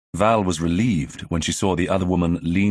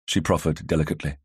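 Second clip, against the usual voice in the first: usual speaking voice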